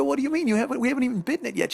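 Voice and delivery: high-pitched, quick disbelief; imitated incredulous protest. Husband to wife before the first bite, incredulous high protest. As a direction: jump up high and rush the objection.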